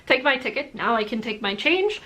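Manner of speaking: ordinary delivery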